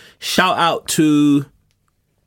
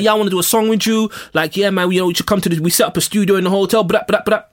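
higher-pitched, hurried imitation of an eager hanger-on; annoying Canadian guy; 5 semitones higher, faster than usual. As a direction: gush like a hype man, ending in brraps. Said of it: "That's what he was saying".

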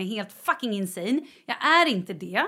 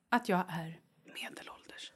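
horrified whisper; 5 semitones lower, 12 dB quieter than usual. Real, not acted. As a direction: confess it in a hushed whisper. Viskar sin stora ångest. (förskräckt viskning)

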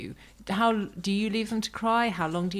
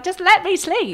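high, loud, hammed-up plea; playfully fed-up outburst; mock exasperation; 12 semitones higher, 10 dB louder than usual. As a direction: protest like someone pestered at bedtime. Acting out disturbed would-be sleeper.

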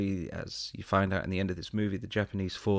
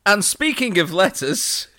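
much higher and louder, slow; dramatic segue; 12 semitones higher, 12 dB louder, slower than usual. Acted. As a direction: announce it like an ominous cue.

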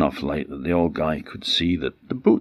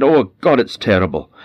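horrified; 5 semitones higher, 6 dB louder than usual. Acted. Wife horrified at the feet.

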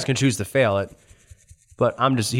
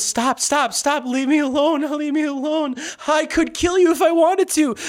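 high-pitched, hammed-up protest; mock panicked pleading. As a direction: squeal it like a cornered villain. Grabbed villain begs to be released.

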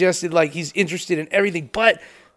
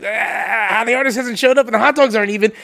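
mock panic; 4 semitones higher than usual. Promoter learning the artist hasn't shown up, mock panic. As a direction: fret in a raised, hammed-up voice.

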